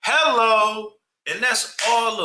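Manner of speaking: sharp high, loud "Hello"; emphatic agreement. Real, not acted. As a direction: slam it home like it's obvious.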